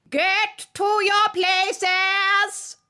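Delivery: very slow, high, loud command; thundering queen. A narrator's reading. Queen thundering orders to the croquet players. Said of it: "in a voice of thunder".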